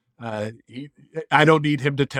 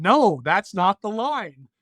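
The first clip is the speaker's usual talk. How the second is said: slow insistent correction; 6 semitones higher, 3 dB louder, slower than usual. Retold or imitated. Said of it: "I said".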